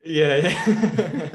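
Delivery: laughing yeah, yeah. Real, not acted. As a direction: agree while laughing. Laughs agreeing with co-host.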